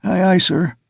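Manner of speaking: put-on high, timid boy's reply; small high-pitched nervous voice; quavering youngster. A narrator's reading. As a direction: answer an officer in a frightened quaver. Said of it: "the youngster said in a quavering voice".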